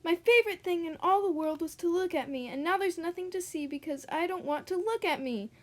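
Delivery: high, anguished pony voice; distressed lament. Acted. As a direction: wail it like a vain pony. Horse lamenting his looks.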